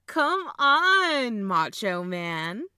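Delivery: hollered, laughing encouragement; excited goading. Acted. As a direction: egg your brother on to perform. Egging brother on to do impression.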